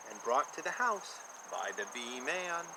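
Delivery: ordinary delivery, nothing marked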